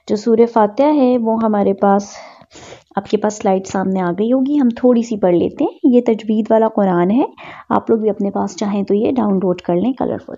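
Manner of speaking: usual speaking voice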